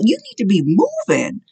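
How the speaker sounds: high, full-force preaching cry; fiery preaching. Acted. Preacher urging the congregation to let God shake them, in a fiery high cry. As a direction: preach it out at the top of the voice.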